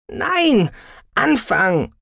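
high, loud, punched cries; explosive daughter's retort; furious contradiction. A narrator's reading. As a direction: shout each word defiantly. Daughter contradicts mother angrily.

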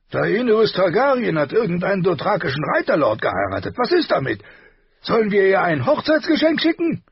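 loud, high, blustering king voice; gruff king. A narrator's reading. Robert voiced in audiobook.

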